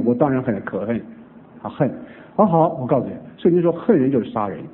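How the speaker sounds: ordinary talk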